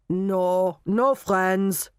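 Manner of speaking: put-on robotic answer in a German accent. A narrator's reading. Hypnotised Knockman answering Molly, robotic. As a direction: state it like a programmed German robot. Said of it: "stated Knockman robotically".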